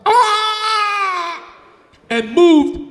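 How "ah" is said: long, high "aah" of effort; straining mountain noise. Acted. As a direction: imitate a mountain heaving itself up. Mimics mountain lifting off.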